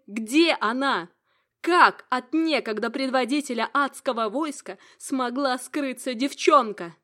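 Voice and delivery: loud, furious reproach; furious shouting. Acted. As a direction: shout every reproach at him. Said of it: "перешла на истошный крик". (гневный крик)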